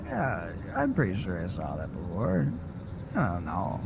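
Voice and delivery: low, soft, swooping mumble; put-on low doofus voice; mock dim friend. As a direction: imitate someone vaguely unsure, hammed-up. Mimicking friend's vague, dismissive reply.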